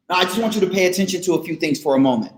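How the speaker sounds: fast, rattled-off sales line; quick pitch. Pretend. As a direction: rush it like a pushy salesman.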